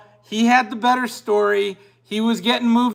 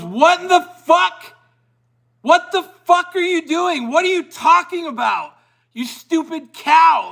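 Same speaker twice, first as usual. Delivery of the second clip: higher, imitating an outraged chef yelling; hammed-up retelling of a furious celebrity chef rant; mock outraged chef, pitched up and cussing; Gordon Ramsay tirade; 8 semitones higher than usual. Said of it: "he goes on a tirade".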